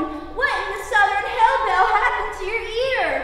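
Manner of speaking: high-pitched shouted imitation of a woman; shouting woman. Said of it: "she's shouting".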